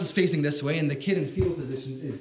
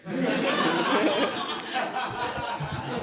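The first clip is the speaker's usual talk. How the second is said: high burst of laughing; real laughter; 8 semitones higher, 4 dB quieter than usual. Real, not acted. Laughing at own story.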